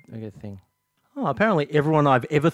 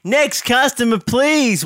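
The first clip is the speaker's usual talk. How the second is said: clipped snippy cashier; 7 semitones higher, slower than usual. Acted. Shopkeeper calling next customer.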